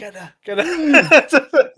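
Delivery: high, slow, laughing mock mob chant; mob yelling. Crowd turning on Steph, laughing mock mob yell. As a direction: ham up an angry crowd while laughing. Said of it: "They're all like".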